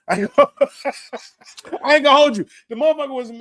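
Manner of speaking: high, loud words through laughter; laughing hard. Real, not acted. Admitting he'd have lost the fight, laughing hard. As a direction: crack up while confessing.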